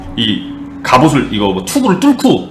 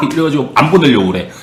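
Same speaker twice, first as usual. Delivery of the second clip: ordinary talk, nothing marked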